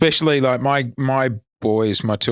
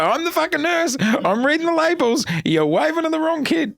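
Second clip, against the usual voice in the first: much higher, a laughing scold; hammed-up nurse snapping at a confused dad; mock exasperated nurse. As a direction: tell him off like a fed-up nurse.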